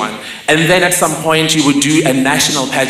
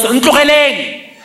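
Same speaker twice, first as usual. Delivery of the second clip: mother yelling; 8 semitones higher than usual. Retold or imitated. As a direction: yell it out the window, defiant. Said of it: "and yells".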